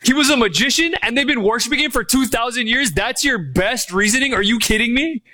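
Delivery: quick, rising disbelief; incredulous. Acted. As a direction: challenge them with rapid rhetorical questions. Mocks the magician theory.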